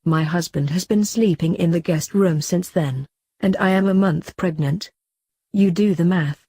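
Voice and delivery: plain reading voice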